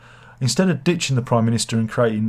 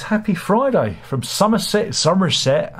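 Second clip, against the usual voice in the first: put-on jokey high, swooping Somerset accent; 8 semitones higher than usual. Host reading out a viewer's greeting from Somerset, mock Somerset accent. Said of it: "speak with a Somerset accent".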